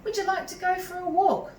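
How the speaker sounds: lightly voiced boy inviting the dog; boy gently asking a scared dog; boy's voice. A narrator's reading. Boy coaxing shy dog.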